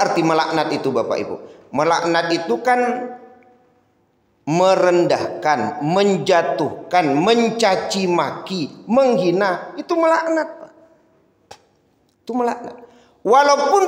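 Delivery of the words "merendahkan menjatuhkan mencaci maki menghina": each word drawn out slowly; slow listing. Acted. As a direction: spell out each meaning deliberately. Lists meanings slowly. (menyebutkan satu per satu dengan lambat)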